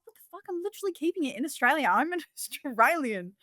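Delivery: high, fast indignant retort; exasperated, laughing at the end; retold outrage. Farmer answering haters, high exasperated retort. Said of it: "And I was like".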